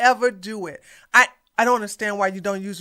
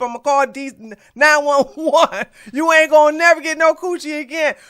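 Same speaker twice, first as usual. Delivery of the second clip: ordinary talk, nothing marked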